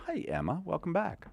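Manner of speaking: ordinary talk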